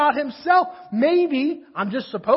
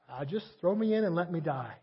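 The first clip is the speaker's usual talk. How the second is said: low, softer, resigned sulk; sulky Jonah; 8 semitones lower, 10 dB quieter than usual. Retold or imitated. Preacher voicing Jonah on the boat, low and sulky. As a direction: give up with a sullen sigh.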